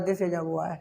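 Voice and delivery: low and soft, "a" as a passing ah; casual unstressed A. Shown as a demonstration. Teacher mimicking people who don't point, casual unstressed "a". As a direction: toss it off, no weight on "a". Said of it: "संकेत अंकित नहीं करते".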